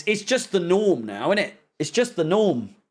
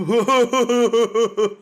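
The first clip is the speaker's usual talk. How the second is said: mocking fake laugh; 3 semitones higher, 4 dB louder than usual. Fan mimicking the manager's joke, mocking laugh. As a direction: laugh hollowly at a bad joke. Mocks Potter's joke about his job.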